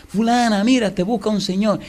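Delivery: high call to someone in back; husband calling his wife to the door. Retold or imitated. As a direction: call her from the other room. Husband calls wife from house.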